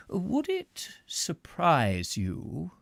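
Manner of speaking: slow menacing question. A narrator's reading.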